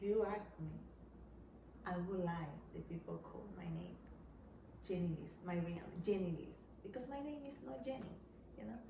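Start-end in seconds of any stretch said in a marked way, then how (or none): none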